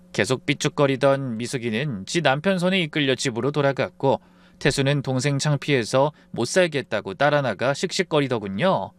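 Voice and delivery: ordinary delivery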